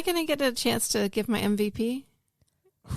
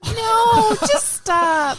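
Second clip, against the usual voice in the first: high loud cry to stop; pleading cry; 12 semitones higher, 8 dB louder, much slower than usual. Real, not acted. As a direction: beg them to stop, half crying. Begging them to stop.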